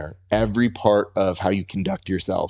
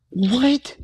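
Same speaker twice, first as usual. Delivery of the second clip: sudden high-pitched disbelief, much higher; surprised what; 10 semitones higher than usual. Retold or imitated. To the fighter, recalling his shocked reaction: high surprised disbelief. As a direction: recall being startled, pitch jumping up.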